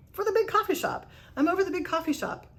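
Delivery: chirpy, insisting she's been there all along; eager insistence. Retold or imitated. Answering coworker's shock about the coffee shop, chirpy and insistent. She repeats answer to his surprise.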